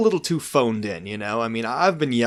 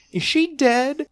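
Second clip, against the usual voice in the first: imitating Denise; 5 dB louder, slower than usual. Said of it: "the way Denise delivered that line".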